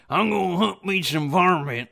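loud, high yokel holler, laughing; hillbilly hunter voice. Retold or imitated. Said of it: "I'm like".

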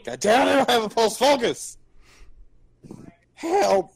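frantic, fast yelling; panicked shouting. Acted. Panicking over a fallen ally.

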